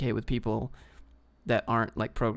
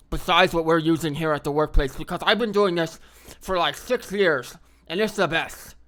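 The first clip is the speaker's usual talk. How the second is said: elitist team lead; 4 semitones higher, 5 dB louder than usual. Acted. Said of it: "an elitist team lead".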